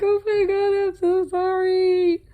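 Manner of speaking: high, loud, slow apology in distress; high-pitched horrified oh my god; panicked apology. Retold or imitated. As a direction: cry out sorry to the baby. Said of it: "I'm like".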